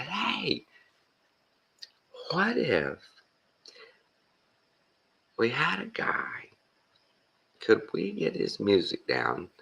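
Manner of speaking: very high, slow imitated pitch; mock-hopeful proposal, high and halting; tentative pitching. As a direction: float the idea in a drawn-out high voice. Comedian retells pitching his idea.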